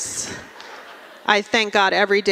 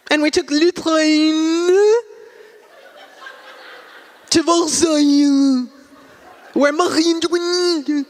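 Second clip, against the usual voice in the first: higher, hammed-up French on every place name; pretentious French accent; 7 semitones higher than usual. Friend brags about first Paris trip, exaggerated French accent. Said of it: "come back speaking in an accent".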